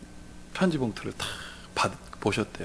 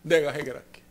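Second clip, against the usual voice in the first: imitated son; 2 semitones lower than usual. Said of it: "그러더라고요".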